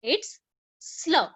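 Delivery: "slur" said as one blended sl sound. Shown as a demonstration. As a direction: glide s straight into l, as in "slur". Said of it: "then what it becomes?".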